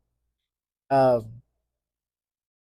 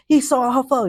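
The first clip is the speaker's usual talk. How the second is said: much higher and louder, a hype rapper quote; 12 semitones higher, 8 dB louder than usual. Retold or imitated. Quoting [name] to viewers, hyped. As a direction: toss out the line like a rapper. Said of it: "how, like, [name] said".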